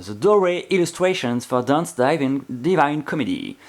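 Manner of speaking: slow English title with a heavy French accent; English read by a Frenchman, French accent. Real, not acted. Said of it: "vous avez un peu mon accent".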